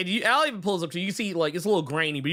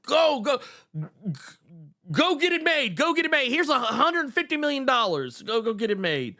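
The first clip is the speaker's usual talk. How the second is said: much higher, urgent mock executive voice; hyped TV exec; 9 semitones higher than usual. TV exec throws millions at the Manimal pitch, high frantic excitement. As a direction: greenlight it on the spot, hammed-up and high. Acting exec greenlighting the show.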